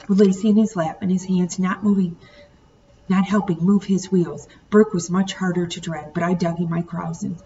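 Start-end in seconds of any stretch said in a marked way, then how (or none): none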